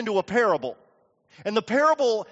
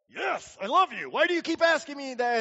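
high, hurried protest; acted fed-up reply, pitch climbing; exasperated; 6 semitones higher than usual. Peter snaps at being asked three times, exasperated.

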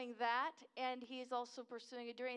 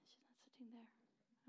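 whisper; 20 dB quieter than usual. Real, not acted. Aside while greeting friend.

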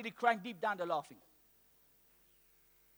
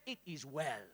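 calm reply; 9 semitones lower, 8 dB quieter, slower than usual. Retold or imitated. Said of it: "You know what she said?".

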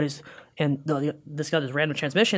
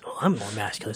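lightly mock-deep macho voice; Star-Lord copying a voice; 6 semitones lower, 7 dB quieter than usual. Captain trying to out-man the newcomer, mock deep. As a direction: drop low and soft, playing manly. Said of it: "So he starts copying his voice".

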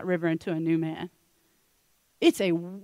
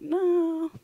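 dismissive no; 8 semitones higher than usual. Acted. Elisha's offhand refusal to Naaman.

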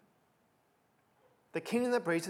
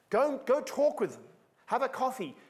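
eager urging; 8 semitones higher, 3 dB louder than usual. Acted. Urging readers to ask witnesses.

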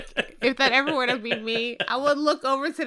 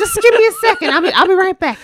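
higher, louder flustered plea for a moment; panicked husband; 6 semitones higher, 4 dB louder, faster than usual. Retold or imitated. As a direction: beg for a second, rattled. Husband voiced begging for time.

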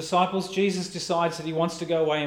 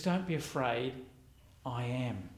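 soft reassuring Jesus; 3 semitones lower, 7 dB quieter than usual. Retold or imitated. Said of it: "But Jesus says".

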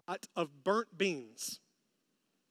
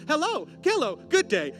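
high, bright sing-song hello; cheery villager greeting; 8 semitones higher, 7 dB louder than usual. Pretend. Villagers greeting in Beauty and the Beast, cheery. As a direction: greet like a musical townsperson.

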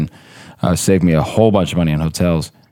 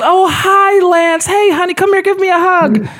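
very high, louder sing-song mom voice; imitating his mom; 3 dB louder than usual. Mom greeting Lance at the door, very high doting voice. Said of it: "She goes".

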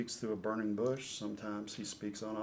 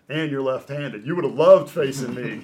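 mock indignant outburst; 4 semitones higher, 15 dB louder than usual. Retold teasing retort to Brett.

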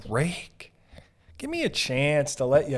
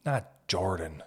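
a hint of a sour critic, lower and slow; grumbling critic; 6 semitones lower, 3 dB quieter, much slower than usual. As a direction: sneer it out like a disgruntled voter. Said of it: "that I was like".

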